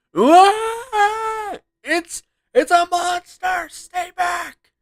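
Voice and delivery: high, loud, slow frightened cry; panicked shout. Acted. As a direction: shriek in terror at the monster. Monster appears, panic.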